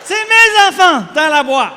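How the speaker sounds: high, very loud bad French; hammed-up frantic French insistence; panicked shouting. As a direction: shout it desperately to clear things up. Panicked fix with more bad French.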